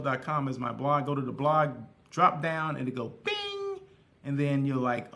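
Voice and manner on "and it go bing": playful bing. Acted. Imitating a notification sound.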